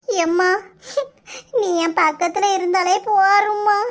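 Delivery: sobbing, pitched high; cling to mother with a sobbing plea; crying. Acted. Bride cannot bear leaving her mother, crying. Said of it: "அதான் அழுதுட்டே இருக்கா".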